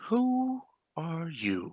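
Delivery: breathy unvoiced whisper, slow; a slow whisper to a silent prisoner. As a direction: hiss the question out slowly. Whispered question in tense scene.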